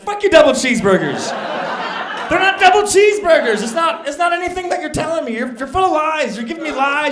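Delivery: loud, high furious yelling; comic's furious climax at the corporate rep; furious rant. Retold or imitated. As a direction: blow up at him completely.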